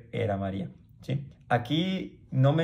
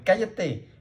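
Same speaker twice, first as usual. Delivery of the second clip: mock rebuke; 7 semitones higher than usual. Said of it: "le reprendieron y le dijeron".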